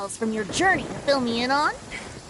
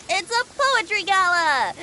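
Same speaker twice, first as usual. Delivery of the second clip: excited reveal; 6 semitones higher than usual. Acted. Proudly reveals the event.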